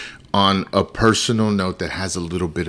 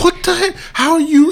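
very high, loud mock indignation; mock outrage; 4 dB louder than usual. Husband mimics himself getting mad at wife. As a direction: squeal it out, offended.

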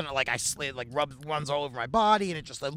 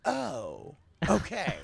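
dazed; 3 semitones higher, 4 dB quieter, much slower than usual. Retold or imitated. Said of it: "I was having a spell there".

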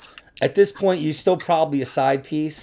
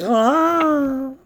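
high, drawn-out mock oh; a light imitation of the strange oh; imitating the moan; 12 semitones higher than usual. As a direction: mimic the odd groan, pitched up. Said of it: "It was like".

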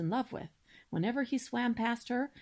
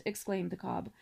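ordinary delivery, nothing marked

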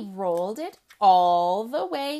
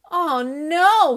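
moaning; 5 semitones higher, 8 dB louder than usual. Acted. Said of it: "he moaned".

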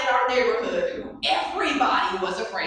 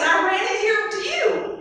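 put-on much higher, slow little-girl voice; childlike voice; 11 semitones higher, 4 dB louder than usual. Girl explaining to her mother, high little-girl voice. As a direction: sound small and innocent.

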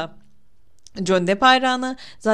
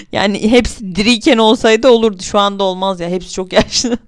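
laughing, loud; 10 dB louder than usual. Real, not acted.

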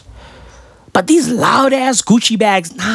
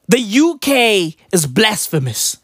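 usual speaking voice

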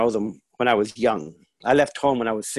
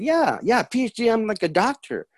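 re-enacted eager reply, pitched higher; lightly acted son explaining himself upward; 8 semitones higher than usual. Son explains his degree to his mom, mildly eager. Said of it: "I said".

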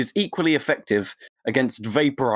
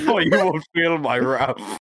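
slow hammed-up menace through laughter; laughing villain threat.

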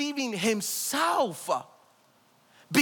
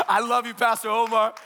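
usual speaking voice

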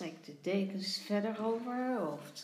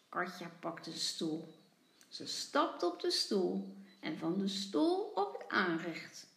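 plain reading voice

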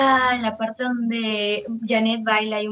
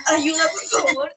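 high, tearful mock cry for help; retold panicked cry; 7 semitones higher than usual. Contestant retelling her onstage fall, tearful mock cry. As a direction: replay the fright of the snagged dress. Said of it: "Entonces yo estaba así".

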